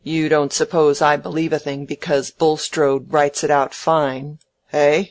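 contemptuous Featherstone. Acted. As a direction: challenge with scorn, punching the final "eh".

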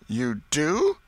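a hint of the sharp old aunt; lightly voiced doubting old lady; pressing aunt. A narrator's reading. Aunt presses the boy, lightly voiced.

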